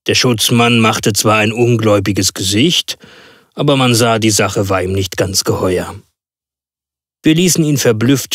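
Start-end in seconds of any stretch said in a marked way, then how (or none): none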